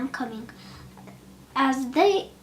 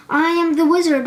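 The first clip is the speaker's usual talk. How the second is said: loud, higher roar from a monster; roaring beast; 4 semitones higher, 9 dB louder, faster than usual. A narrator's reading. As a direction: roar it to scare the Tin Man. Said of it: "roared the beast".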